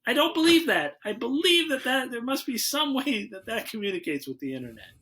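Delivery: high, emphatic retold protest; mock insistence. Retelling his argument with partner over the gadget, high insistent protest. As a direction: argue back, pitched well up.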